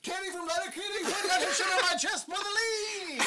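race caller. Pretend. Mock race call.

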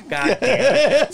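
high, drawn-out mock groan of a curse; retold curse, slow and squeaky with laughter; laughing exasperation. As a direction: give in helplessly through laughter. Acted reluctant giving in, laughing.

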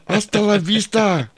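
high, drawn-out action-star catchphrase; Arnold impression. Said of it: "And Arnold's like".

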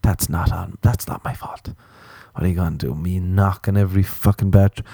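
ordinary talk, nothing marked

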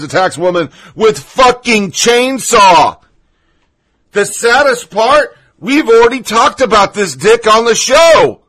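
loud, indignant rant; outraged. Real, not acted. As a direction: raise your voice in outrage. Outraged ranting about attack.

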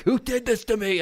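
high, indignant cry to the sky; mock outrage. Retelling being tricked, indignant high wail. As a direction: wail it like a betrayed victim. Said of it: "I was like".

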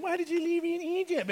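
high-pitched hurt plea; upset. Acted. God upset at being rejected, high plaintive question. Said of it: "he's just, oh, so upset".

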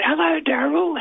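very high falsetto greeting. Retold or imitated. Booth announcer greeting Daryl on air, high falsetto. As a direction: squeak it out in your highest falsetto. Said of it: "rallied my most falsetto voice I could".

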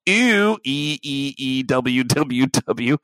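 EW stretched long, then spelled out letter by letter; drawn-out ew. Retold or imitated. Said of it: "it was really long".

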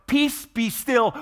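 high, slow commanding shout; loud command. Retold or imitated. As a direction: order the storm down, slowly and loudly. Jesus commanding the storm, retold.

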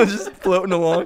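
ordinary talk

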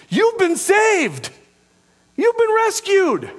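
very high-pitched, exclaimed sermon delivery; impassioned preaching. Real, not acted. Preacher reminding believers they are saved, impassioned preaching. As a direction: proclaim each line at a soaring pitch.

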